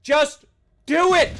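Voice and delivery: impatient, punchy yell; loud urging. Acted. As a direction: burst out with the order. Same urging, now shouted.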